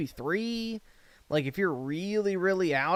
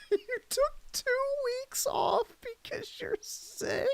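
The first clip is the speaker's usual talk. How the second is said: mock disbelief; 6 dB quieter than usual. Mocking disbelief at two weeks off.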